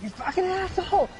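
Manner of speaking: thin, high-pitched retold scream; imitated screaming. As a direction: imitate a woman screeching at a driver. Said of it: "Like, screaming at him".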